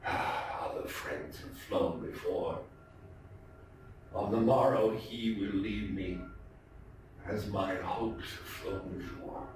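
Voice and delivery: low, soft, barely voiced mutter. A narrator's reading. Narrator lamenting to the raven, low half-breathed mutter. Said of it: "scarcely more than muttered".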